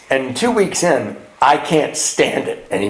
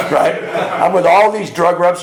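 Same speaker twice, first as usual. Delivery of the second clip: ordinary talk, nothing marked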